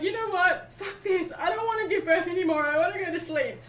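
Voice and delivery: high, loud, laughing give-up; exasperated laughing surrender. Retold or imitated. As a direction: throw in the towel while laughing. Surrendering in labor.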